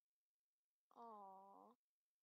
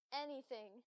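loud pick; 10 dB louder, slower than usual. Acted. Picking a dialogue option.

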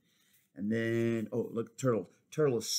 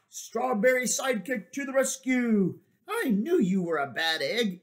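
high heroic shout; heroic strawberry; 10 semitones higher, 5 dB louder than usual. Acted. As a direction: burst in to save the day. Hero bursts in.

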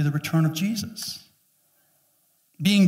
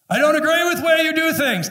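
much higher, louder mock whine; whiny complaint; 12 semitones higher, 6 dB louder than usual. Church member complaining to God, whiny. Voicing a complaining congregant praying.

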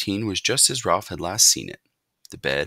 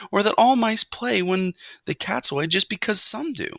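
high, earnest argument; young mouse, protesting; 13 semitones higher, 4 dB louder than usual. A narrator's reading. Young mouse defends boy to his mother, protesting. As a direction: argue back in a raised youngster's voice. Narrator voices Ralph arguing.

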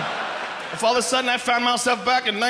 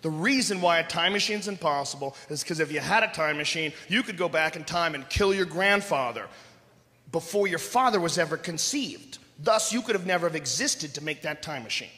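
condescending nerd, a bit lower and softer; pedantic guy; 6 semitones lower, 4 dB quieter than usual. Retold or imitated. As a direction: explain it down to him smugly. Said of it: "And the guys".

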